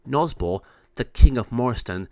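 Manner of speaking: plain reading voice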